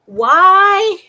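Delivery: loud high exasperated WHY; outraged yell. Real, not acted. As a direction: shout the question in disbelief. Outraged shouting at mayor.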